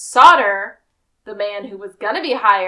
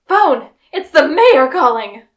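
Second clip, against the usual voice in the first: louder, higher announcing shout; urgent call; 4 semitones higher, 9 dB louder than usual. Retold or imitated. Someone rushing into the party with news, loud. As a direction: burst in with the news. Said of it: "runs into the room and goes".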